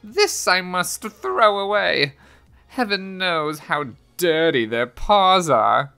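high-pitched indignant housewife's tirade; complaining woman. A narrator's reading. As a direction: rant in a high lady's voice about pests. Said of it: "complained a woman".

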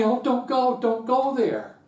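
high, loud mock wail of pleading parents; mock crying parents. Parents begging their child not to go, in a high mock wail. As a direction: ham up a tearful mom begging.

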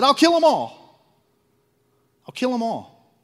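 loud, high furious declaration; God's wrath. Retold or imitated. God vowing to wipe out the sinful people. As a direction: voice God ready to destroy them. God voiced angry at sinners.

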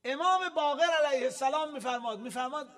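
loud, high, slowing proclamation; emphatic, stretched-out sermon voice; forceful preaching. Real, not acted. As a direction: proclaim the Imam's saying with weight. Proclaims a saying loudly.

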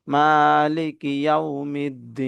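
slow মালিকি ইয়াউমিদ্দিন, last "ee" held long; three-alif stretch. Shown as a demonstration. Teacher modelling the verse ending, মালিকি ইয়াউমিদ্দিন slowly drawn out. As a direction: recite মালিকি ইয়াউমিদ্দিন, stretching the final vowel three beats. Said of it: "এখানে মধ্যে আরজি তিন আলিফ টান".

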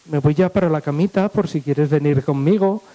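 low seductive voice, hinting; flirting blonde. Acted. Blonde hints to Beni about going to bed, seductive. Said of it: "se le acercó una rubia".

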